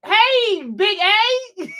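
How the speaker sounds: bright high hey to a tall friend; greeting Big A. Retold or imitated. Greeting a friend met in person, excited high call. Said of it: "I was like".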